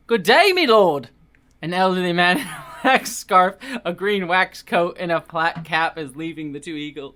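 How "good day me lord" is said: put-on loud, high British country accent; British yokel greeting. Old man hailing the lord in British accent. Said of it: "going for all the British accents".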